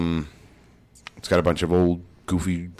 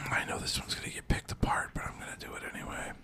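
very soft whisper; whispered aside; 16 dB quieter, faster than usual. Real, not acted. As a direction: whisper it like a reluctant confession. Hesitant aside before risky item.

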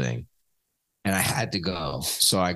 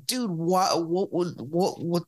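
exasperated; 6 semitones higher, much slower than usual. Retold or imitated. Retold exasperated complaint to brother.

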